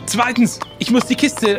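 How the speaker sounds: ordinary talk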